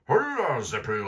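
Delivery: high, loud hollering call. A narrator's reading. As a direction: call down into the pit. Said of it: "rief Hotzenplotz".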